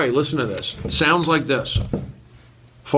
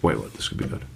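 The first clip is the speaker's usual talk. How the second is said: whispered; 9 dB quieter, faster than usual. Real, not acted. Hushed remark before the sounds.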